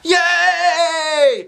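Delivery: high, loud sung-out yay; hollered cheer. Acted. Kids holler with joy at the offer. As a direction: whoop it like excited kids. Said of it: "everybody hollered".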